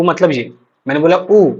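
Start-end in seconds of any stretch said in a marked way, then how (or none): 1.2-1.5 उ held out as a long oo; long vowel. Shown as a demonstration. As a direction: stretch उ to double length. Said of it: "ये हमारा दीर्घ".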